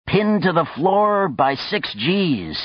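slow, high hammed-up terror; mock horror. A narrator's reading. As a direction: wail it in fake panic. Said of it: "he cried in mock horror".

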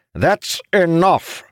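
high, drawn-out angry shout; commanding shout. A narrator's reading. Father breaks up his sons' quarrel, shouting. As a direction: thunder it to silence the room. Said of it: "Aranel shouted, his voice near shaking the room".

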